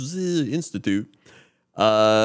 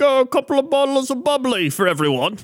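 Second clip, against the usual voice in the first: put-on high, plummy British accent, higher pitched; posh British man; 11 semitones higher than usual. Host imitating a British man on a train in a posh British accent. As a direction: offer bubbly like a plummy British gent. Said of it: "He literally was like".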